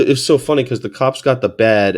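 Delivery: ordinary talk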